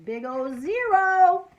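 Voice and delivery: loud, high, drawn-out exclamation; exaggerated dismay. Boy admitting how many books he read, exaggerated dismay. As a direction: hammer it out slowly, stretching the zero. Narrator dramatizes having read nothing.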